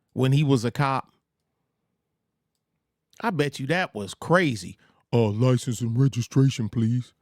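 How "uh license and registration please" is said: lightly voiced traffic cop; a hint of a deep official cop. Imagining Truth Teller pulling someone over, mild cop voice. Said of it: "being arrested by Truth Teller".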